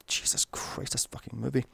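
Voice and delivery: whispered under the breath, fed up; exasperated whisper. Real, not acted. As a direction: hiss it out in despair. Muttered exasperated aside.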